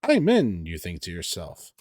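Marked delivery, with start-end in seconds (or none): 0.0-0.6 slow, high, pleased with himself; smug. Acted. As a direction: savor the hacker moment. Quoting game text, inner thought.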